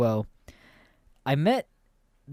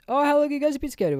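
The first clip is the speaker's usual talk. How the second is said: put-on high, quick kid voice; kid's question; 14 semitones higher, faster than usual. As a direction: mimic the young kid, bright and fast. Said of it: "and he just goes".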